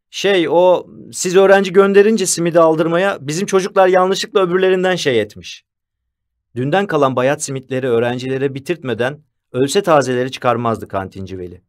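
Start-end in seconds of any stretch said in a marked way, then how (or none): 0.1-5.6 higher, louder excuse-making; hesitant start, then raised-voice explaining; flustered canteen keeper. A narrator's reading. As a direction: blurt out a sheepish excuse.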